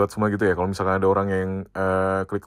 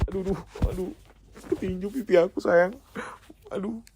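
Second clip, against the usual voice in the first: mock ouch; 8 semitones higher than usual. Pretends to punch own cheek.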